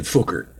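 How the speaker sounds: "fucker" said as "fooker"; imitated pronunciation. Streamer explains what the friend sounds like, "fucker" turned into "fooker". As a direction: put the vowel of "book" into "fucker". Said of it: "he sounds like he's saying".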